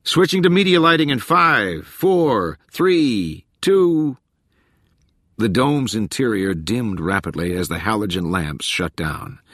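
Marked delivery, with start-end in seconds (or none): 0.0-4.2 high, loud studio countdown; projected technician's call, each number separate; shouted countdown. A narrator's reading. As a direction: count down loudly for the whole crew. Megaphone countdown to lights.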